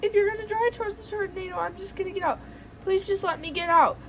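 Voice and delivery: high-pitched mock begging; retold crying plea. Begging to be let out of the car, mock crying. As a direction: plead high, acting out tearful panic. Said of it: "like, crying at this point".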